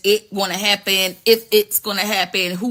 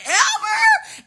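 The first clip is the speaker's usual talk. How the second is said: high squeaky disbelief with a laugh; hammed-up gasp of disbelief; shocked squeak.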